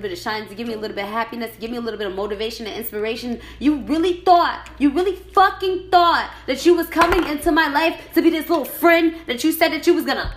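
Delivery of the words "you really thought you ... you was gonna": raised, loud, indignant rant; angry confrontation. Real, not acted. Confronting the friend who betrayed them, loud real anger. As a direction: hit it hard, high and angry.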